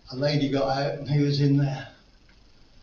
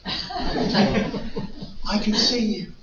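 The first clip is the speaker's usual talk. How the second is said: slow words broken by laughter; laughing through it; 6 semitones higher, much slower than usual. Real, not acted.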